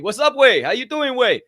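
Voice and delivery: high, loud mock güey talk; Mexican slang imitation. Host copying coworkers' slang, high and loud mock accent. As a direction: throw güey on everything, hammed-up. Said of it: "I just start talking like them".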